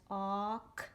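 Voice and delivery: AUK read slowly with a long aw; base word demo. Shown as a demonstration. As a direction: stretch the vowel in "auk". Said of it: "just gonna read the base word".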